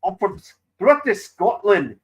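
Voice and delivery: slow, high, loud Scottish accent demo. Shown as a demonstration. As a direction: show how a Scot says it. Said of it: "go like this".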